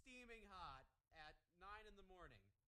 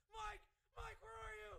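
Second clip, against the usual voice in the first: high, loud imitated shout; hammed-up panicked holler; frantic yelling; 11 semitones higher, 7 dB louder than usual. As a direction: yell out in mock panic for a lost buddy.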